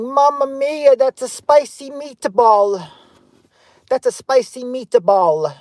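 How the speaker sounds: high, stretched-out mock Italian exclamation; cartoon Italian man. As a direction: draw it out high like a hammed-up Italian. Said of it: "no men running around saying".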